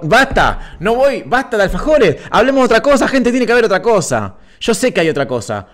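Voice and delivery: high, pleading outburst, climbing in pitch; mock exasperation. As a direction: beg them hammily to change the subject. Begs chat to drop alfajores. (exasperación exagerada, con voz muy aguda)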